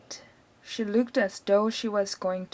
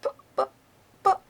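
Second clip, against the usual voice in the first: stammering; 11 semitones higher, faster than usual. A narrator's reading. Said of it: "stammered George's mother".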